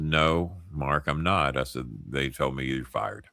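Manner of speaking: low, soft apologetic delivery; sincere, sombre. Retold or imitated. Friend telling Mark he is fired, sincere and sombre. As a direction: let the voice sink as you apologise. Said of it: "I'm pitching it to him just like I'm doing with you".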